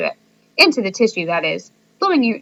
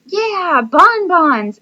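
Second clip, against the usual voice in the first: excited cheer; 9 semitones higher, 5 dB louder, much slower than usual. A narrator's reading. Class cheers the idea.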